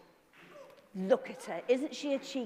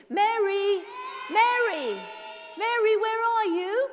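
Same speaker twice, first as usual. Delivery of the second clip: high, loud calling out; 9 semitones higher, 5 dB louder than usual. Shown as a demonstration. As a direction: call for her across the room. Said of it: "can you call out for Mary".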